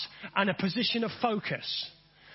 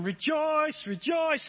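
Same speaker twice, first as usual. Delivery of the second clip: higher sung-out choir master cue; 6 semitones higher, slower than usual. Retold or imitated. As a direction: lead the choir from one side. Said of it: "if you had a choir master here, you'd be going".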